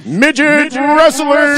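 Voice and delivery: high, loud, slow ring announcer; announcer shout. Acted.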